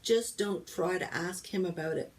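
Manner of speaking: ordinary delivery, nothing marked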